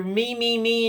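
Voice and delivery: high, drawn-out mocking whine; mock whiny. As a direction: imitate a self-centred whiner. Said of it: "were so whiny".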